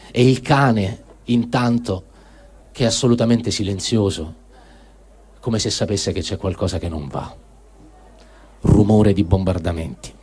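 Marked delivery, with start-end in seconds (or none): none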